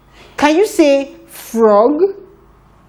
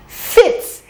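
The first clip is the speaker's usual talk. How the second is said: "Fit." breathed out unvoiced, higher; whispered blend; 8 semitones higher than usual. Shown as a demonstration. Teacher blends the sounds for the class, whispered. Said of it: "blend it a little faster".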